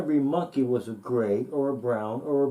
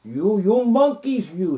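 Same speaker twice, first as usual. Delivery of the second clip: louder, higher, slow and scolding; cross peddler scolding monkeys; 6 semitones higher, 8 dB louder than usual. Acted. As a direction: wag a finger and scold the monkeys. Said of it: "he said".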